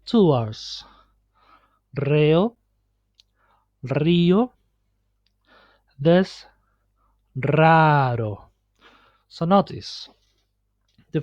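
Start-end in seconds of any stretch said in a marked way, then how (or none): 1.9-4.5 trilled first R in "Reo, río"; "Reo, río" with strong Spanish trills; multiple R. Shown as a demonstration. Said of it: "it's always pronounced as multiple R". 7.4-8.5 "raro" with trilled first R, tapped second; "raro" as a teacher's careful Spanish demo; multiple then simple R. Shown as a demonstration. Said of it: "it's always pronounced as multiple R".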